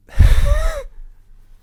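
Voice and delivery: a loud real laugh breaking the take. Real, not acted. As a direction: burst of high, loud laughter after flubbing. Laughs at repeated flubbed line.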